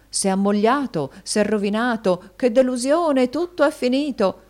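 rising, high wail of disappointed ladies; theatrical imitation of jilted admirers; mock lament.

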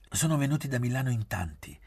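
ordinary delivery, nothing marked

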